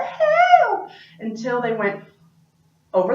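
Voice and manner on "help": very high, loud little help; the baby crying for help in the distance; baby's faint cry. Acted. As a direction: squeak it like the baby.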